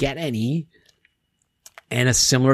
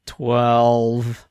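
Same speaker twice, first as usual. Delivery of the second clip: sheepish, lower mumbled answer; scolded schoolchildren; 3 semitones lower than usual. Retold or imitated. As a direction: answer like a caught-out pupil. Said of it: "Like, like scolded school children".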